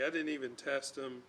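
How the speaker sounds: normal talking voice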